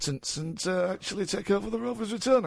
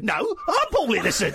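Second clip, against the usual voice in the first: higher, laughing caricature; playful rival impersonation; Paul Innocent; 4 semitones higher than usual. Acted. Host claiming to be the caller, high jokey voice. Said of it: "I can do an impression of you".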